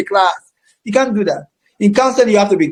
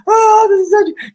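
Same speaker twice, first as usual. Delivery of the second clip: mock tearful doctor; 11 semitones higher than usual. Teacher mocking a surgeon who cries, high tearful whine. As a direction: whine it like a doctor about to cry.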